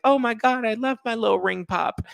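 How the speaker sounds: very high, squealy imitation; mock delighted gushing. Young fiancee loving her cheap ring, imitated squeal. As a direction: gush in a tiny put-on high voice.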